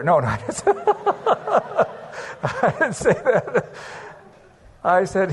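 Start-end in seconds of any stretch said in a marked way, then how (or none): 0.7-1.9 high laugh. Real, not acted. Laughs at his own joke.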